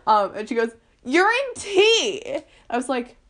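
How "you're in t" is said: high, loud, drawn-out news; excited announcement. Retold or imitated. Older kid telling Penelope her part, excited call.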